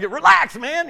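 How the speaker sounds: pushy, high-pitched comic shout; mock yelling. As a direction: yell at someone to relax. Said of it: "they're kinda nervous and you yell".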